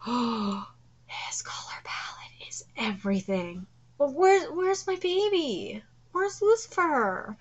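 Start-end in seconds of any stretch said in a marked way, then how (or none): 0.0-3.7 breathless, slow whisper of awe; awed whisper. Real, not acted. Streamer admiring the animation, whispering in awe. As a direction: hush it like you can't believe the beauty. Awed aside while watching anime.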